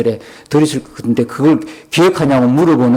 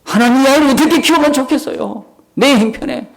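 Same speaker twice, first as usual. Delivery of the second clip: higher-pitched, mock-tearful pleading; tearful plea; 11 semitones higher than usual. Pastor pleads with God over raising the child, imitated tearful plea. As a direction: plead upward as if crying, voice raised in pitch. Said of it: "그렇게 울면서".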